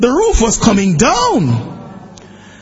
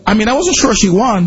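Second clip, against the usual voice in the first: usual speaking voice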